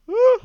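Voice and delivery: big open loud real yawn. Real, not acted. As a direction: let a full yawn out. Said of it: "I couldn't fight it any longer".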